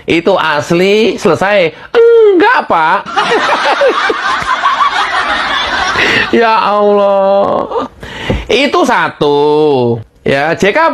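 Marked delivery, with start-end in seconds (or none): 3.0-6.2 breathy high laughter; long high laugh. Real, not acted. Host mocking JK, high laugh. Laughs after "Enggak, Pak. 6.3-7.9 slow drawn-out exasperated "Ya Allah"; exasperated sigh. Real, not acted. Host despairing at JK's stance, slow exclamation.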